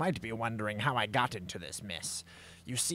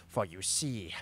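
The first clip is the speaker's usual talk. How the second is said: normal talking voice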